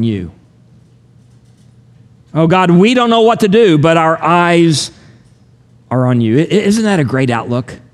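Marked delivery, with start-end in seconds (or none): 2.3-6.4 impassioned prayer. Acted. Pastor praying the king's words aloud to God, impassioned then hushed. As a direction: cry out to God, then settle into trust. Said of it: "repeats the verse as a heartfelt prayer".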